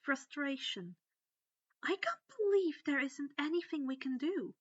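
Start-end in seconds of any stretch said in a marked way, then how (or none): 1.8-4.5 frustrated. A narrator's reading. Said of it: "growled in frustration".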